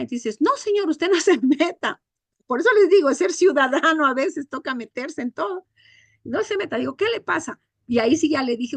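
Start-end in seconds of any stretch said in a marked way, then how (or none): 0.4-2.0 higher-pitched mock indignant rebuff; imitated rebuff. Neighbour telling the teacher to keep out, imitated indignation. 6.2-6.8 quick high-pitched mock rebuff; imitated rebuff. Neighbour warning the teacher to stay out, imitated indignation. 7.0-7.6 high-pitched indignant question, re-enacted; retold confrontation. Teacher confronting the neighbour who hit his child, retold indignation.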